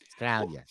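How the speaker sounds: soft "Australia" with no first A; clipped Australian pronunciation. Shown as a demonstration. Repeating the Aussie example, "Australia" as a soft 'Straya. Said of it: "you can't say letter A".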